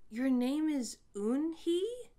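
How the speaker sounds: put-on a boy surprised to hear her name, asking slowly; surprised question. A narrator's reading. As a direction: ask it amazed, drawing it out. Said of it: "with his eyes open wide".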